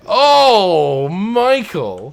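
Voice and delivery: loud high outraged wail at teasing; outraged cry. Real, not acted. Cohost mocks his aim, so he cries out in loud outrage. Teased about bad aim.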